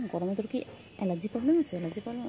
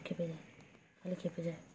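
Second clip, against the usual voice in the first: usual speaking voice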